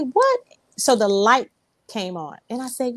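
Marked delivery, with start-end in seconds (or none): 0.0-0.4 sharp high "What?"; a startled squeak of a question; surprised. Retold or imitated. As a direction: jump up high in disbelief. Shocked reply to manager.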